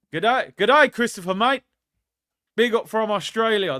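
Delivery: loud, high-pitched Australian greeting; Australian accent. Acted. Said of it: "That was my Australian accent".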